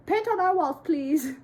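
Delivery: high, loud mock begging; pleading parents. Parents begging daughter for a mural, mock pleading.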